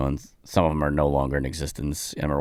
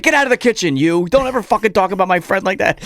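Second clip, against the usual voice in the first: high, loud mock tirade; hammed-up furious comic, higher and louder; mock angry rant; 14 semitones higher, 7 dB louder than usual. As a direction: scold him off the stage. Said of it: "He's like".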